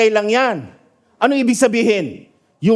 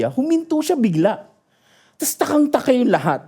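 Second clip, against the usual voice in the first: usual speaking voice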